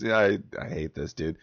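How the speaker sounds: usual speaking voice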